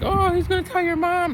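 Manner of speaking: put-on high sing-song kid tease; squeaky oooh-you're-in-trouble voice; taunting classmate. Classmates teasing the fresh student, high taunting kid voice.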